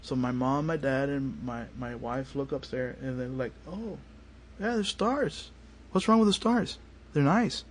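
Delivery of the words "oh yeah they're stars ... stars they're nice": higher-pitched mock polite interest; unimpressed family. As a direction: imitate relatives humouring him, pitched well up. Retold family reaction to stars.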